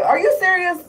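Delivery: high, disbelieving "are you serious"; incredulous. Retold or imitated. Replaying her own disbelief at her boyfriend's flip-flop, incredulous. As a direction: throw it back at him in disbelief.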